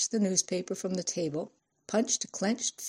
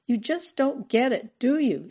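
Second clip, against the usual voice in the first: raised, higher-pitched tearful reproach; sobbed louder; 5 semitones higher, 4 dB louder than usual. A narrator's reading. Cindy in tears at Ralph, a louder mock sob. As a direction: push it out higher and louder, as if through sobs. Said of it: "she sobbed louder".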